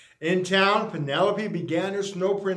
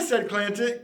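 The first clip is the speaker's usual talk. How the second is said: ordinary delivery, nothing marked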